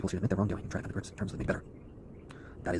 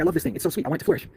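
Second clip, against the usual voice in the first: higher, louder mock-tender gush; doting voice; 8 semitones higher, 5 dB louder than usual. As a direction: gush over it like a beloved pet. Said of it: "It's like".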